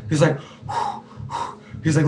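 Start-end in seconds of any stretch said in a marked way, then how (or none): 0.6-1.5 soft blown-out breath, imitating a rattled friend; panicked exhale. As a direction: blow out a nervous breath. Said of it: "He started panicking. He started shaking".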